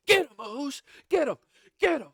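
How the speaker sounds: high, loud egging-on yell; mock cheering shout. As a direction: urge him on like a hyped ringside brother. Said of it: "Jey Uso goes".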